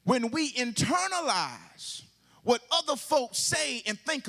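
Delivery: high, ringing pulpit proclamation; impassioned sermon delivery, stretching key words; full-force preaching. Real, not acted. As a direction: raise the pitch and proclaim it to the congregation.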